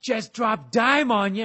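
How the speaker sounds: loud, high interrogator's taunt; slow it down and hammer it, imitating a yelling principal; mock yelling. Principal bluffing the brother in questioning, mock yelling. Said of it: "he's yelling at my brother".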